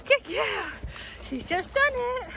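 high, loud praise for the dog. Real, not acted. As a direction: cheer the dog on with big praise. Praising the dog after her run.

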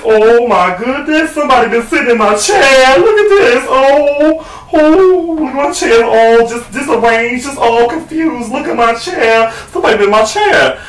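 high, slow, wailing mother bear; Mama Bear. Acted. Mother bear finding her chair disarranged, high mock dismay. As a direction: draw out the fussy high lament. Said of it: "Mama Bear says".

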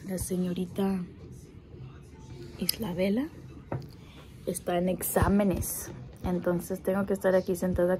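hushed voice so as not to disturb; speaking softly. Real, not acted. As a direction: keep it low, someone's studying nearby. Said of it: "Tengo que hablar un poquito bajo".

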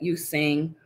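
ordinary delivery, nothing marked

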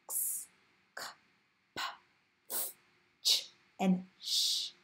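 "s, k, p, ch, sh" as bare voiceless sounds; unvoiced consonant sounds. Shown as a demonstration. Teacher demonstrating unvoiced sounds, each isolated without voice. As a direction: make each consonant alone, no vowel, pausing between. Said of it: "are all unvoiced sounds".

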